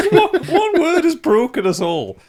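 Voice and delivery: high, loud, slow words through laughter; helpless laughter. Real, not acted. Player to the table broken by one word, helpless laughter. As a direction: squeal it out while cracking up. Table in fits over name.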